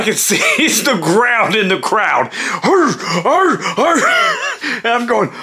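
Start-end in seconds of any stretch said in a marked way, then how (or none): 2.6-4.4 high, punchy effortful grunts; imitated grunts. Wrestler carrying the narrator round the ring, imitated grunting. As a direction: grunt like a wrestler lugging a load.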